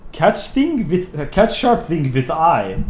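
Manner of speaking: slow, hammed-up Russian voice; Russian accent. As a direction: drag it out in a put-on Russian accent. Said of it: "Do the Russian voice".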